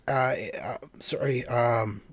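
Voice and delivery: soft whispered apology under breath; whispered aside. Real, not acted. Aside apology while searching for name.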